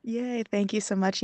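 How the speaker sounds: usual speaking voice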